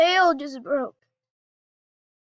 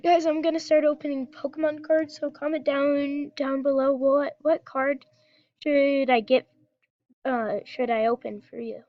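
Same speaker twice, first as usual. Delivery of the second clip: ordinary talk, nothing marked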